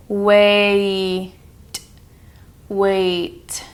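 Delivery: "Wait" stretched like A E; ai vowel demo. Shown as a demonstration. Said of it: "So listen again".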